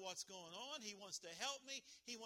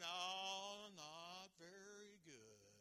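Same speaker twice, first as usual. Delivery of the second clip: mopey churchgoer; much slower than usual. Retold or imitated. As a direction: drag it out like nothing's ever fine. Gloomy churchgoer answers preacher.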